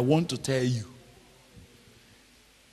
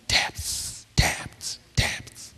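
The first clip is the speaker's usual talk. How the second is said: whispered, echoing each word softer; hushed repetition; 5 dB quieter, much slower than usual. Real, not acted. Preacher whispering the key word to his listeners. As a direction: breathe the word like a secret.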